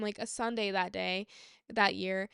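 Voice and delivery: ordinary delivery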